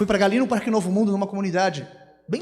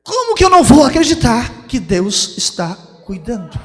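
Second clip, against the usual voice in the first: much louder, stretched-out emphatic question; exclamação enfática de pregador; 13 dB louder, slower than usual. Real, not acted. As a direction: proclaim it to the congregation, drawing out the words. Repete a frase pregando. (exclamação de pregador, alta e arrastada)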